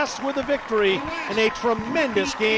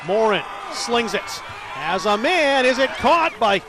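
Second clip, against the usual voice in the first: quick game call, rising on the catch; live play-by-play; 3 semitones higher than usual. Real, not acted. Announcer calling the pass for radio listeners, lively play-by-play.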